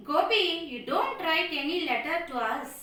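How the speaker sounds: ordinary delivery, nothing marked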